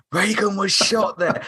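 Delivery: gasp, then a high-pitched dramatic reveal; imitating someone scandalised, pitch leaping up; mock shocked gasp. Retelling the Hilton's grim history to mum, mock shocked. Retold gasping revelation about the hotel.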